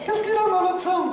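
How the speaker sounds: put-on high, indignant puppet with Toulouse accent; thick Toulouse accent. Puppet challenged about how she talks, thick Toulouse accent. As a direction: answer back in a squeaky southern Toulouse accent. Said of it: "Bah, il a un gros accent".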